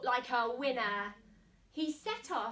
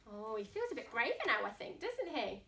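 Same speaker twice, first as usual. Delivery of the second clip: ordinary delivery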